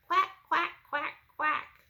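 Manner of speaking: high, loud duck quacking; Mama Duck voice. Acted. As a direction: call out like a mother duck. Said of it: "Mama duck said".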